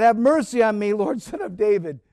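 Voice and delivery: loud, high desperate cry for mercy. Acted. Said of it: "She cried out".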